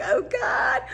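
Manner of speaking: high embarrassed groan; a mild wail of embarrassment; mortified. Retold or imitated. Woman carried past onlookers, mock-mortified high groan.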